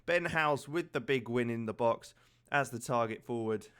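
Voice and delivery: usual speaking voice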